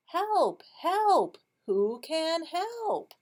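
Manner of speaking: high, loud cry for help in singsong; duck calling for help. Acted. Teacher reads the stuck duck's refrain for choral reading, high calling voice. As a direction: call out high, stretching the last question. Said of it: "what does the duck say".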